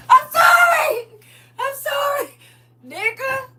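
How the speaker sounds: high, loud, crying sorry; begging forgiveness in high sobs; wailing apology. Acted. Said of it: "you be like".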